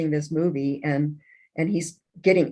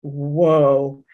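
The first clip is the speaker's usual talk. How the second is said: stunned whoa; 6 dB louder than usual. Retold or imitated. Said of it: "I looked like that".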